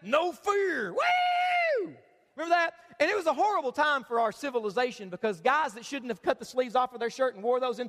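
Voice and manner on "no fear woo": a hammed-up high yell of No fear, then Woo; mock macho shout. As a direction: shout it like a pumped-up gym guy. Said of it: "everybody used to wear".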